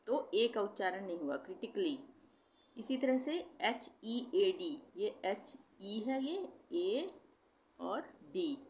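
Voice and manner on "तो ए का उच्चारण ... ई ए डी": usual speaking voice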